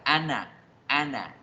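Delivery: "Ana" as an plus the first vowel of umbrella; linking drill. Shown as a demonstration. Teacher isolates the link for learners, "Ana" as one run. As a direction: drill the joined syllables "Ana". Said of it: "de la pronunciación".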